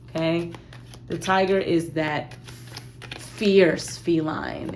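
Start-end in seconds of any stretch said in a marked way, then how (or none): none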